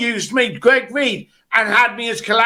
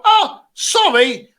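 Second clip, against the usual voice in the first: drawn-out, higher mock-apologetic "oh, sorry"; feigned apology; 8 semitones higher, slower than usual. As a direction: act out a polite excuse, pitched up and slow. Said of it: "very easily you just go".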